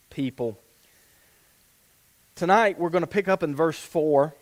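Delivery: ordinary talk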